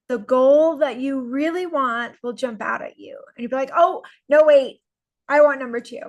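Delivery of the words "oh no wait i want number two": louder, urgent change of mind; sudden realisation. Acted. Goal coach voicing someone whose true goal jumps out, sudden realisation. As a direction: blurt out a sudden preference.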